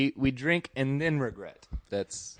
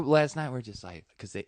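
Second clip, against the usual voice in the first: ordinary talk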